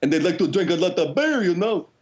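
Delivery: put-on bouncy, mumbled Northern Ontario accent; hammed-up rural Ontarian, words bouncing around. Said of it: "that's how they talk in Northern Ontario".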